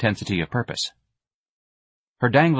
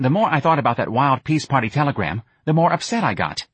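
put-on higher, brash, lecturing young woman; earnest Myrna; 4 semitones higher than usual. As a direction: rattle it off, intense and self-important. Myrna explains her trip.

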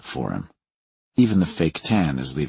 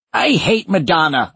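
put-on grumpy old man; 11 semitones higher, 5 dB louder than usual.

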